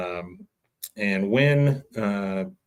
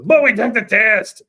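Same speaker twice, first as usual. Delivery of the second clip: much higher, louder indignant whine; mock whiny protest; 11 semitones higher, 9 dB louder than usual. Jimmy insisting they took the test, high whine. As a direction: squeak out the protest like a dim guy.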